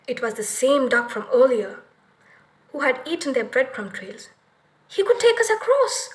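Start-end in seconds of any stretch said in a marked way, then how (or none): 4.9-6.1 much higher, bright discovery; light up with a sudden idea; excited girl. A narrator's reading. Gretel seeing the duck as their way across, excited. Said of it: "Gretel said".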